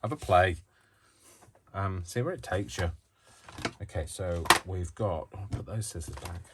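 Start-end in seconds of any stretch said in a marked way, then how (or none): none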